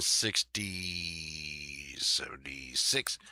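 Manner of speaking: slow, hushed whisper. Real, not acted. Telling viewers the price, slow and whispered.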